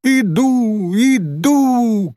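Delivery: high, drawn-out answering call; acted girl's shout, held on the vowel; calling back. A narrator's reading. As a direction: sing out as if to someone far away. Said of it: "крикнула Софичка".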